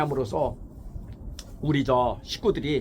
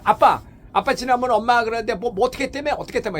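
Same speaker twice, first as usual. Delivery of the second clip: put-on child talking back; 7 semitones higher, 6 dB louder, faster than usual. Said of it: "이렇게 대들어봐".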